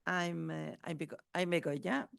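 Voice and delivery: ordinary talk, nothing marked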